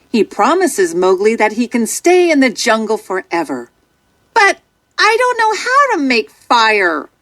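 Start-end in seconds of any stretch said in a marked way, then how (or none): 4.3-7.1 put-on high boy's voice, earnest; a child pleading honestly; sincere boy. A narrator's reading. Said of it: "Mowgli explains to him sincerely".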